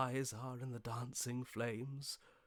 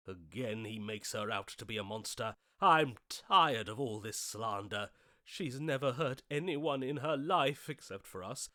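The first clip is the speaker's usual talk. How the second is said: normal talking voice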